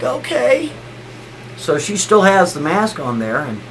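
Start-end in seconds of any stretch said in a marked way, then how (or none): 0.0-0.7 very high, small mock-tearful voice; tearful, shaking wife. Sick wife gives in to husband, high and tearful. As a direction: imitate a trembling woman reluctantly agreeing. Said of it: "she's just a shaking".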